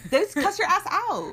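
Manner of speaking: high, loud disbelief; incredulous. Real, not acted. Friend mocking American siblings, incredulous. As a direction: burst out in disbelief.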